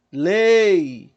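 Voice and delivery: long stretched "Lei" to prove the strong vowel; sustained E. Shown as a demonstration. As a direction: hold the E of "Lei", brief I at the end. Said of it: "qual é a vogal que se sustenta mais".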